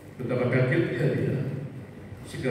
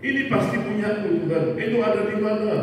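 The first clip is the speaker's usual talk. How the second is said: much higher, pleading cry; desperate plea; 10 semitones higher than usual. Acted. Man on the beach calling to God, high desperate plea. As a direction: lift the voice high as if about to break.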